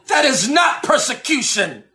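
normal talking voice

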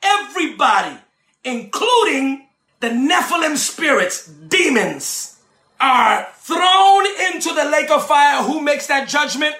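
rising, shouted sermon cadence with long held words; preaching at full force. Acted. Preacher preaching judgment of angels to his listeners, shouting. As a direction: preach it to the congregation, building to shouts.

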